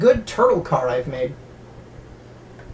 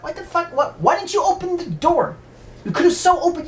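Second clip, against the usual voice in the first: high mock outrage; hammed-up scolding of a child; angry parents; 11 semitones higher than usual. Parents scold the girl for not opening the door, high mock outrage. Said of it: "They're like".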